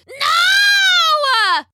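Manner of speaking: high, loud scream; witch screams no. A narrator's reading. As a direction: shriek in despair. Witch cut off by sword.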